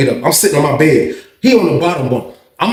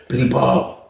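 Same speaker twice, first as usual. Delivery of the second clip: ordinary talk, nothing marked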